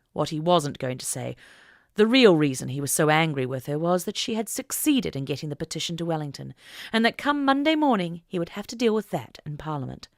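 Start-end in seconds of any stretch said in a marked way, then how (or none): none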